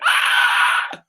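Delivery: loud comic yell of alarm; hammed-up scream; shocked scream. Beggar man realizes he met the king, loud scream.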